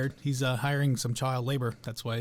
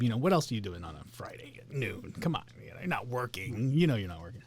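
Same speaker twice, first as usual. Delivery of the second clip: whisper; 5 semitones lower, 7 dB quieter than usual. Acted. Teasing viewers in whisper.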